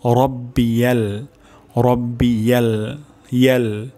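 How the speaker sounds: "রব্বিয়াল" slow, ending in a fronted "yel"; model pronunciation. Shown as a demonstration. Teacher drilling the next word, "রব্বিয়াল" said slowly with yel. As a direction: stretch "রব্বিয়াল", last vowel as e. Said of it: "তারপর শব্দটি হলো".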